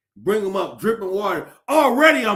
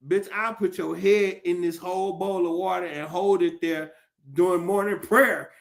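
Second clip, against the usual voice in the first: usual speaking voice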